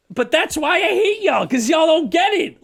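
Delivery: shouted, high and loud; heated retold outburst; angry yell. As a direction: yell it at the yuppies. Angry rant about yuppies.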